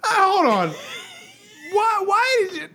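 high-pitched exasperated outcry. Acted. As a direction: protest in a high, strained mock voice. Acted exasperated reaction to guest.

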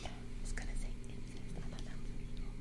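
hushed whisper. Real, not acted. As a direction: whisper it so nobody hears. Whispers taboo word incest.

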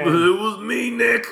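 high, loud hammed-up confession; mock villain reveal. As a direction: play the culprit revealing himself, high-pitched and theatrical.

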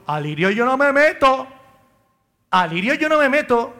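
a touch of a parishioner brushing him off; imitated parishioner. Parishioners telling new priest they stay out, mildly mimicked. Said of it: "y yo".

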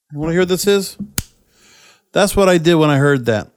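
ordinary talk

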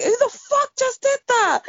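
high-pitched re-enacted yell of outrage; shouting at screen. Fan recounting their reaction to the match, outraged shouting. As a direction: relive yelling at the telly, voice shooting right up. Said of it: "I really was shouting at the screen".

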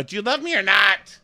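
high, loud insistent demand; hammed-up pleading ultimatum; mock demanding. Acted demand for a love declaration.

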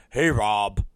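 low, dopey mimicry; mocking employee impression. Unreliable employee greeting his boss, low mocking voice. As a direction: imitate a sheepish caller, voice dropped low. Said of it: "He goes".

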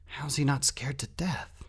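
slow, high, whispery thought; amazed whisper. A narrator's reading. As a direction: whisper it slowly in disbelief.

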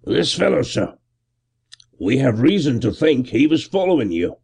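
lightly voiced policeman, respectful; deferential constable. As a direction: report it to the gentleman.

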